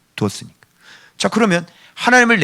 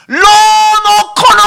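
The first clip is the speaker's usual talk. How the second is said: very high, loud, stretched-out call; long-distance holler; 14 semitones higher, 6 dB louder, much slower than usual. Shown as a demonstration. Preacher tests if Australia can hear him, hollering. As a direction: holler slowly as if to another country.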